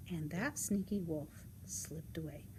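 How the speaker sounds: usual speaking voice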